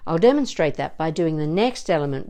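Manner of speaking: ordinary delivery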